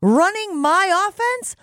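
high, slow mock-relieved sigh; relieved coach voice. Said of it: "He may be like".

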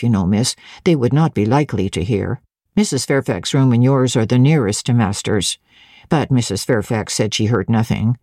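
low-pitched, guarded servant voice; wary servant. A narrator's reading. Servant explains to the governess who could hear, warily. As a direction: answer low and careful, giving nothing away. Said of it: "She seemed to examine me warily".

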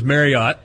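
Marriott as MARY-ott, with a full "ott"; colloquial pronunciation. Shown as a demonstration. Host offering the colloquial name of the hotel chain, colloquial Marriott with "ott". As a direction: end Marriott on a clear "ott", not "ut". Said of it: "more colloquially known as".

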